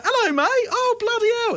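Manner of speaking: high mock cheery mates. Mates popping out of fridge.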